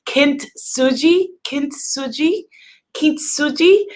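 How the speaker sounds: "Kintsugi" tried carefully, each ending on a questioning rise; tentative pronunciation. Real, not acted. Host naming a Japanese art she may mispronounce, tentative rising "kintsugi". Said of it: "I'm going to mispronounce it".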